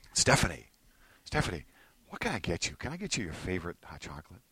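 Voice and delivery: soft, low coaxing as a doting partner; tender doting. Acted. Partner to tired wife home from work, tender. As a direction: offer it gently, low and hushed.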